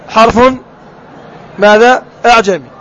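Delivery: usual speaking voice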